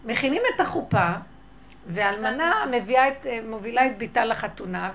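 ordinary talk, nothing marked

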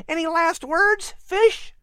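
put-on high, eager boy voice; a curious boy talking to a cat; young boy Patrick. Boy Patrick wondering aloud to the cat, high and eager.

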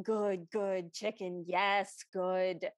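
low, blunt one-word troll grunts; feasting troll. Acted. Troll sits eating chicken, lightly acted low satisfaction. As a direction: savor each word low, as a greedy troll. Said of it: "is just eating chicken, going".

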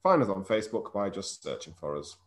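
announcer read. Acted. Reads the show's plug.

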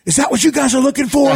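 high, loud mock-furious challenge; retold angry scream. Bidder turns on the TV crew, retold angry scream. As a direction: replay yelling in a rage at the camera. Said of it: "I'm so, I'm so mad".

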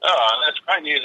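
usual speaking voice

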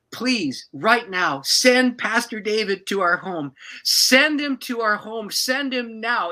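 loud, slow, urgent begging; pleading prayer. Retold or imitated. Couple crying out to the Lord for help, loud slow pleading. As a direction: plead with God, rising. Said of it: "we said".

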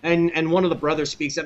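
usual speaking voice

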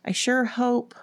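high-pitched hopeful reply; faint excitement. A narrator's reading. Ellen hoping school gets canceled, faintly excited. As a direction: say it with budding hope. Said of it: "a faint stir of excitement".

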